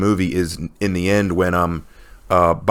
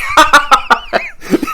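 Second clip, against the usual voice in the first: high delighted laugh; real laughter at a favourite scene; burst of laughter; 4 dB louder than usual. Real, not acted. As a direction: crack up at the joke. Laughing at the movie quote.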